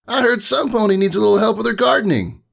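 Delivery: cocky high voice; smug and breezy; too cool. A narrator's reading. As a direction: Rainbow Dash teasing Applejack, too cool. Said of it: "her too cool attitude".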